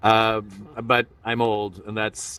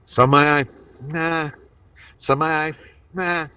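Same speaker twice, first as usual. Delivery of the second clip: a hint of De Niro, winced and short of breath; Robert De Niro; 4 semitones higher than usual. Shown as a demonstration. Teaching the audience De Niro at home, lightly winced. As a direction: squint and puff like De Niro. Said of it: "that's how you do De Niro".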